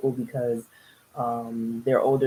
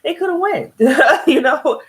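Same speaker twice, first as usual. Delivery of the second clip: laughing; 11 semitones higher, 7 dB louder than usual. Real, not acted. Laughing at couple's choice.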